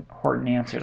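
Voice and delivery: usual speaking voice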